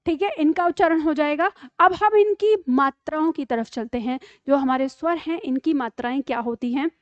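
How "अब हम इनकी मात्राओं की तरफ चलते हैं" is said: ordinary talk, nothing marked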